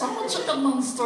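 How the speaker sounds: high, strained silly creature voice; chicken monster voice. Shown as a demonstration. Voice actor showing the chicken monster voice he improvised. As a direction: squeeze it out like a giant baby chick. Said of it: "I started talking like this".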